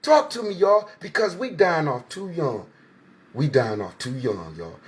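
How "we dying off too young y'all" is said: much lower, softer and slow; a heavy, quiet second saying; solemn echo. Real, not acted. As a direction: let the repeat sink like a lament. Repeats the line, lower and softer.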